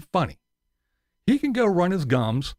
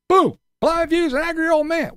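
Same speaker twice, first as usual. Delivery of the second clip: loud, higher mock reveal; louder, higher mock-dramatic headline; dramatic announcement; 8 semitones higher, 4 dB louder than usual. Real, not acted. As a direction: boom it out like breaking news.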